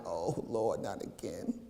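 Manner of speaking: low, very soft moan of despair; despairing whimper. Retold or imitated. New believer crying in the sanctuary, soft despairing moan. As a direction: groan it out like you're worn down. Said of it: "crying like".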